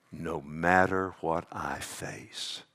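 low and hushed, slowing down. Real, not acted. Pastor quietly assuring congregation, hushed and slow. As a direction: let it sink in slowly and softly.

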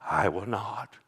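emphatic whisper; hushed repetition. Acted. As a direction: drop to a low whisper. Third repeat drops to whisper.

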